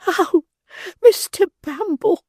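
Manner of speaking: high, loud, slow lady's cry; a fluttering matron crying out. Acted. Matron crying out to the beadle in distress. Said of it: "cried the lady".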